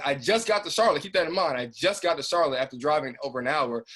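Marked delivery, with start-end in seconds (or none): 0.0-2.6 louder, higher, exasperated; rising indignation at the drive; exasperated emphasis. Retold or imitated. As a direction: hammer home how absurd it was.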